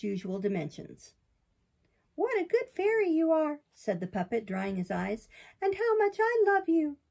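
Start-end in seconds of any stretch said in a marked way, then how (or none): 2.1-3.6 high, bright-eyed puppet, much higher than the narrator; puppet boy voice. Acted. Pinocchio protests to the fairy in a high boyish voice. 5.6-7.0 high, bright-eyed puppet, much higher than the narrator; puppet boy voice. Acted. Pinocchio protests to the fairy in a high boyish voice.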